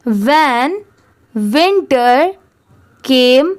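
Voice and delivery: slow word-by-word reading for students to repeat; slow read-aloud. Shown as a demonstration. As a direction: read each word apart with pauses. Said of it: "आप मेरे बाद ज़रूर repeat करिए". (धीमे-धीमे शब्द-दर-शब्द पढ़ना)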